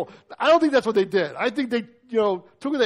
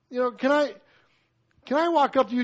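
ordinary delivery

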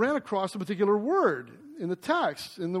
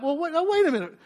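much higher, excited double take; sudden realization; 10 semitones higher, 4 dB louder, faster than usual. Retold or imitated. Preacher reliving the moment the link hit him, excited. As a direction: catch yourself mid-thought as it clicks. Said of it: "And I'm like".

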